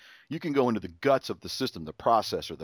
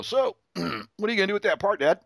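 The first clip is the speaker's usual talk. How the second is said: sly son; 9 semitones higher than usual. Retold or imitated. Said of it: "lean back in his chair and go".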